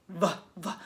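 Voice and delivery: "V, V" as the short sound vuh, vuh; letter sound. Shown as a demonstration. Teacher sounds out the first letter of visit, "V, V" as vuh-vuh. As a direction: voice the bare v sound twice, briefly. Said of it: "begins with V".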